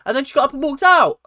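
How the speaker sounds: high, loud, rapid outburst; rushed incredulous yell; exasperated shout. Real, not acted. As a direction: blurt it out in loud disbelief. Exasperated climax of the story.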